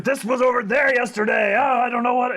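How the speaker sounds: high, loud frantic protest; flustered Kaz. Acted. Kaz defending herself in a stress dream, high flustered shouting. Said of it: "She's like".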